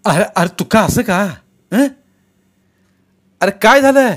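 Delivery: high, loud worried calling; alarmed. Acted. Villagers crying out in alarm over fallen Tuka. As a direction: call out in alarm.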